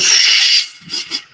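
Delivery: whispered hush; hushing shh. Retold or imitated. Teacher hushing the student, whispered shh. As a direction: silence him with a whispered shh.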